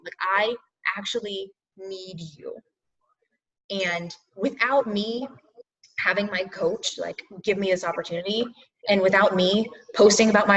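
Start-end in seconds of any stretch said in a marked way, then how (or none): 0.0-8.4 emotional plea. Real, not acted. Coach telling followers she needs them, soft and emotional. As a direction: quietly admit you need them. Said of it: "I'm gonna get all emotional".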